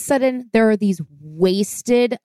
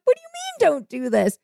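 squeaks up much higher in disbelief; shocked disbelief; 13 semitones higher than usual. Retold or imitated. Proposer hears her girlfriend say don't, in much higher shocked disbelief. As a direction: voice cracks up high in shock.

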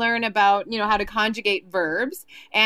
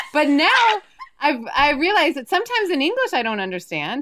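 ordinary talk

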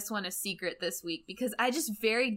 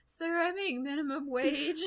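high, slow, weary protest; put-on helpless counter worker; teenage clerk; 6 semitones higher than usual. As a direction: plead mildly in a raised voice. Voicing poor teenage clerk.

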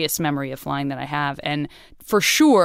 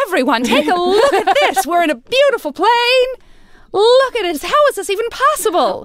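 put-on much higher, louder old-time announcer voice; 1940s voice; 13 semitones higher, 6 dB louder than usual. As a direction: gush like a 1940s newsreel. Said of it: "I'm forced to use a nineteen forties voice".

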